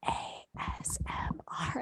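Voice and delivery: very soft, fully whispered letters; ASMR whisper. Shown as a demonstration. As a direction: imitate an ASMR video in a whisper. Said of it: "where they're like".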